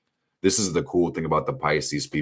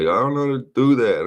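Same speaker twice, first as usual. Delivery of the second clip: high, loud imitation of a whiner; mock helpless complaint; 8 semitones higher, 6 dB louder than usual. Mimicking TikTok viewers who can't find YouTube, high mock whine. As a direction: mimic a clueless complainer, pitched up.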